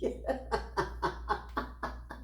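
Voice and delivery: soft, staccato laughter; real chuckle. Real, not acted. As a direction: run of quiet wordless chuckles. Laughs while recalling.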